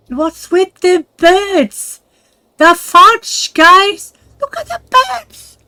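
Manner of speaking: high, loud amazed cries at the birds; sudden squealing amazement; excited shouting. Real, not acted. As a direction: yell in disbelief at the birds.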